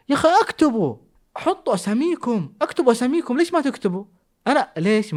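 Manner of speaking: high, whiny mock-frustrated begging; exasperated plea. Begging viewers to put their names, high mock exasperation. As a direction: plead like a fed-up host.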